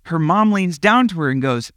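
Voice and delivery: ordinary delivery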